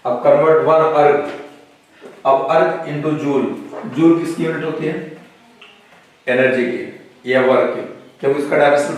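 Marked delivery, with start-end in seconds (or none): 0.2-3.5 slow, drawn-out words for copying down; teacher dictating the problem slowly; slow dictation. Shown as a demonstration. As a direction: spell it out slowly as you write. Teacher reads problem while writing.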